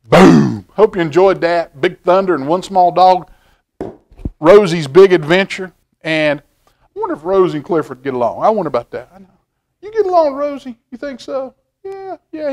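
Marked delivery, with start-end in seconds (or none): 0.1-0.7 thunder boom. A narrator's reading. Closing thunder callback. 9.8-12.5 playful high voice. Pretend. Talks to toy dog playfully.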